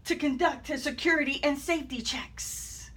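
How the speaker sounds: ordinary delivery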